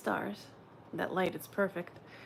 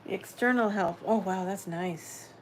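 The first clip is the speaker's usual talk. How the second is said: ordinary talk, nothing marked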